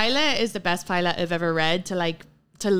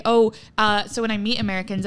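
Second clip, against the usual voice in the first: normal talking voice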